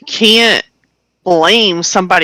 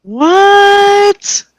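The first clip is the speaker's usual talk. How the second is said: high, loud drawn-out "what"; stunned disbelief, stretched; shocked; 4 dB louder than usual. Real, not acted. Hearing he left the house, high shocked disbelief.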